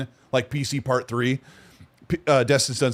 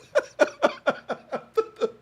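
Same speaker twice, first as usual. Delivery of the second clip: high laugh; 3 dB quieter than usual. Real, not acted. Laughing at the brag.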